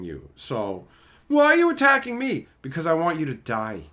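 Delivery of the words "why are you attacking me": high, loud mocking whine; whiny mock opponent. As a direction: mimic the sore loser's whine.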